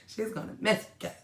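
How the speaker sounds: words squeezed out through laughter; laughing. Real, not acted. Repeats remark while laughing.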